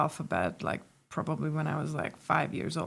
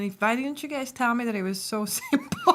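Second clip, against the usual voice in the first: higher retold protest breaking into laughter; laughing mock complaint; 6 semitones higher than usual. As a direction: complain playfully, laughing on the last word. Retold childhood exasperation, laughing.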